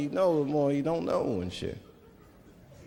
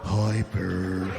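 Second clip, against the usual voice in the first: much lower, slow, drugged drawl; drowsy Snuffy; 9 semitones lower than usual. Retold or imitated. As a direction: drag it out like a strung-out junkie. Voicing Snuffy junkie.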